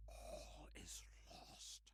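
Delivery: slow hushed despair; despairing whisper. Acted. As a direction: breathe it out hopelessly.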